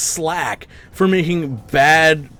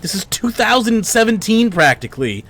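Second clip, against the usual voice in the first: angry rant; 5 semitones higher than usual. Real, not acted. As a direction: vent furiously at the developers. Ranting at game developer.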